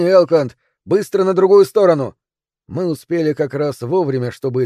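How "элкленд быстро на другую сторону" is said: louder, higher cry of alarm; urgent shouted warning. Acted. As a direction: call out to get him moving fast. Urgent warning under falling oil. (срочный предупреждающий крик)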